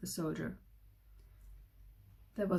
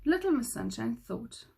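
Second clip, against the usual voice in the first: ordinary delivery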